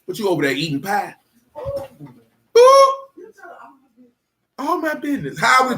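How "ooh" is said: high, loud teasing ooh; teasing ooh at the pie eater. Real, not acted. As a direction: squeal a mock-shocked ooh. Reacting to pie eating.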